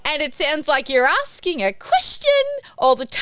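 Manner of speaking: every phrase ends rising like a question; Aussie upward inflection. Shown as a demonstration. Coach showing Aussies their rising sentence ends, rising question tune. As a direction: lift the end of question and time as if asking. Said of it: "you go up at the end of your sentences".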